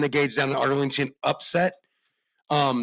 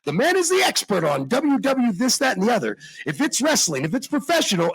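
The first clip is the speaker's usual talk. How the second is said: put-on old-time radio announcer build; 1930s announcer; 7 semitones higher than usual. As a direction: boom it like a vintage ring announcer. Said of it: "I sounded like one of those, like, nineteen thirties announcers".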